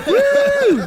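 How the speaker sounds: high, loud whoop of fake triumph; mock jackpot whoop. Friends joking about ATM winnings, a high mock whoop. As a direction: cheer like the ATM keeps paying out.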